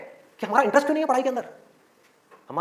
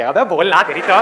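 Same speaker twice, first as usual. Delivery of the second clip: mock-angry heckler, suddenly louder; exasperated outburst; 4 semitones lower, 5 dB louder, slower than usual. Exasperated audience member jumping up at the speaker, mock anger. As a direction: burst out like a fed-up listener. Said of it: "लोग बौखला जाएंगे वहां से उठ करके".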